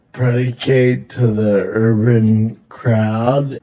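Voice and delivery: predicate to the urban crowd? drawn out slowly, each word spaced; slow, mocking repeat. Mocking her word choice to his friend, slow and deliberate.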